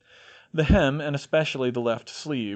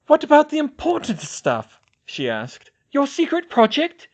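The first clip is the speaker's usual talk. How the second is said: horrified; 7 dB louder than usual. A narrator's reading. Ginny horrified.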